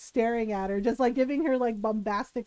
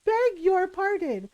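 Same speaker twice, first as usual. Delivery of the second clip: offended vice principal; 7 semitones higher, slower than usual. Retold or imitated. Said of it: "He goes".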